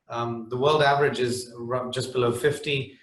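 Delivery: usual speaking voice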